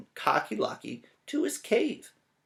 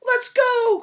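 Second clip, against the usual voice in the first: very high, loud duck panic; high panicked duck; quacking duck; 12 semitones higher, 6 dB louder than usual. Acted. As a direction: squawk it out like a frantic duck. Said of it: "quacked Ducky Lucky".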